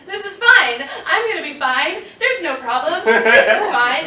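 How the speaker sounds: high, loud, half-laughing forced confidence; nervous laughing self-reassurance. Retold or imitated. Quitting her job, reassuring herself in a high, laughing panic. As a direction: talk yourself into it through panicky laughter. Driving home after quitting.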